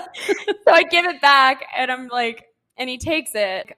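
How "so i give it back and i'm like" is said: slow, louder, laughing through the words; can barely speak for laughing. Real, not acted. Telling the cohost about the hundred, laughing.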